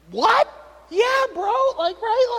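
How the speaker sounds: high delighted what, yeah bro; surprised. Real, not acted. Dad amazed at his toddler's sweet words, high surprised exclaim. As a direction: jump way up in pitch, amazed and delighted.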